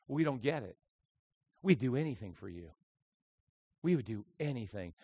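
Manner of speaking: very soft, low retold reply; low, hushed pledge of loyalty; hushed devotion. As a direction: answer quietly as the humbled soldiers. Loyal soldiers answer their moved leader.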